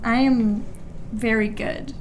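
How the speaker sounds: usual speaking voice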